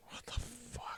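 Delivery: stunned whisper; whispered disbelief. Real, not acted. Hushed reaction to price.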